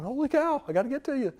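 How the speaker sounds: high, hushed, mock-panicked dog voice; high-pitched separation panic, lightly acted; panicky dog. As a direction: play an anxious dog desperate to follow. Voicing a dog left behind a door.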